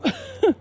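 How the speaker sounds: short high chuckle; brief high laugh to cap the remark. Real, not acted. Storyteller after deciding to go home, short high laugh.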